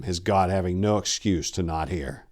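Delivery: ordinary delivery, nothing marked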